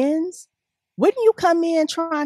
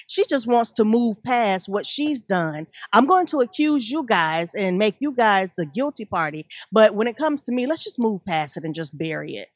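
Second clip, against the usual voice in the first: usual speaking voice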